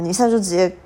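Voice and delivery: ordinary talk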